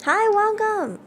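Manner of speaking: high, sing-song welcome; cheery high hello; bright greeting. Real, not acted. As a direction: greet them with a lift. Greeting new member.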